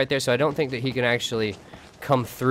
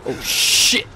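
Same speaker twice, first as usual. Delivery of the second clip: breathy startled yelp; startled gasp; 6 semitones higher, 5 dB louder than usual. Real, not acted. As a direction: caught off guard, gasp it out. Startled by something in dark.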